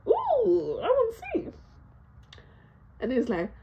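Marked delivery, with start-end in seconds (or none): 0.1-1.5 mock eager curiosity. Kid teasing curiosity.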